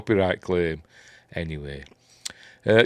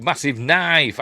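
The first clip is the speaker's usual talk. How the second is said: ordinary talk, nothing marked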